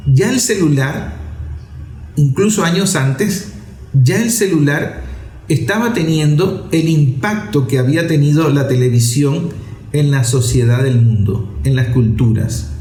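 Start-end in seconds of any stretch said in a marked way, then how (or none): none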